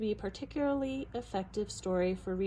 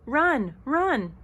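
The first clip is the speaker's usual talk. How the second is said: loud, higher warning cries; urgent yell; 4 semitones higher, 8 dB louder than usual. A narrator's reading. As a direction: yell to send them fleeing. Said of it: "they yelled".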